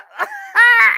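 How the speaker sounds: loud high squealing laugh; shrieking laugh. Real, not acted. Overwhelmed by the song, she shrieks with laughter. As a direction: burst into a delighted shriek of laughter.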